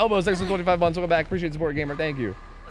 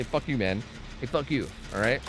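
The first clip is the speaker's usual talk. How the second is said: ordinary talk, nothing marked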